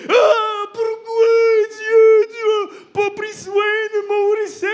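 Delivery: very high, loud wailing plea; hammed-up sobbing cry; mock crying. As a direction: wail at God, overdoing the grief. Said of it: "il a commencé à pleurer".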